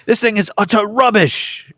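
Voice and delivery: slow, loud disgusted chef's verdict; hammed-up celebrity chef scorn; mock Gordon Ramsay. Chef judging a dish, mock Ramsay disgust. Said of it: "Gordon Ramsay-esque".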